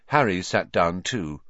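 ordinary delivery

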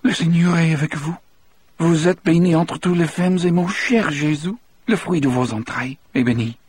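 high, trembling French prayer; Cajun praying. Acted. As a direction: murmur the Hail Mary fervently. Delacroix prays in French.

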